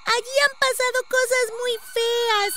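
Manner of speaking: put-on very high, small child voice; a little girl telling of dreadful things; high-pitched village child. A narrator's reading. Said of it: "contestó la pequeña".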